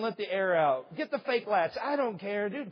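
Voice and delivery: usual speaking voice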